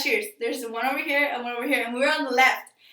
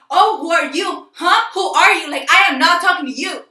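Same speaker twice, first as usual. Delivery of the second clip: mock angry yelling; 3 semitones higher, 8 dB louder than usual. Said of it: "acting really violent".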